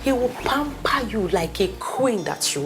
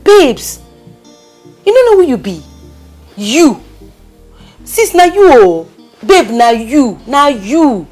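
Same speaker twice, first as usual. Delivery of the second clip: loud calling out; 3 semitones higher, 9 dB louder than usual. Acted. Friend calling out to heartbroken sister.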